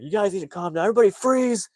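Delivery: high loud command; yelling freeze. Retold or imitated. Cop from Arizona yells at the others, high loud command. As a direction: shout everyone to stop. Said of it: "is like".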